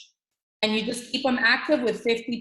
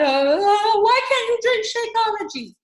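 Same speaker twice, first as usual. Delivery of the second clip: mock whine; 9 semitones higher, 4 dB louder than usual. Mocking a nagging girlfriend.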